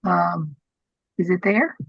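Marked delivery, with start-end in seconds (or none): none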